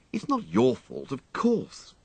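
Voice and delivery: easing off after a sulk; apologetic young master; young toff softening. A narrator's reading. Wooster's voice in story.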